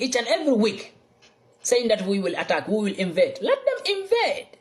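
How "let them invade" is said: defiant dare. Real, not acted. Defiant dare at threatened invasion.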